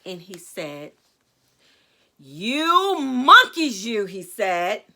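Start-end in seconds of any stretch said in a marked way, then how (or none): 2.2-4.1 loud, drawn-out scolding; scolding peddler. A narrator's reading. Peddler shaking a finger at monkeys, scolding. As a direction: tell them off loudly, stretching the words. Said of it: "shaking a finger at them".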